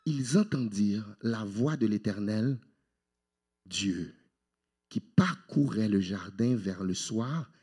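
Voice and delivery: low, soft, measured verse reading; scripture reading. Acted. Preacher reads Genesis to the congregation, low and measured.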